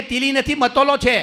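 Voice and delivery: high loud lisped correction; loud lisping retort. Retold or imitated. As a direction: correct him loudly with a lisp. Lisping bride corrects him.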